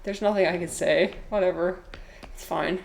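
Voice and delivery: ordinary talk, nothing marked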